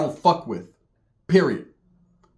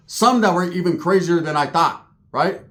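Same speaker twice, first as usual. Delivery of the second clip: ordinary talk, nothing marked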